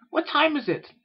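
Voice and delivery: very high, loud question; alarmed filly. A narrator's reading. Filly suddenly worried about the time, high urgent voice. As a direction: ask urgently in a squeaky young voice.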